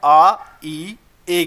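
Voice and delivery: आ, ई, ए each held and set apart; drawn-out vowel sounds. Shown as a demonstration. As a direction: sound out आ, ई, ए as long separate vowels. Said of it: "sound आता है".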